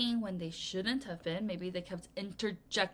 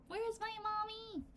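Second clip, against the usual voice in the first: put-on much higher, small voice of a lost baby; 12 semitones higher, 7 dB quieter than usual. Teacher pretends to be the lost baby, high and small. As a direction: whimper it up high like a tiny lost child. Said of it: "I was a baby like the one".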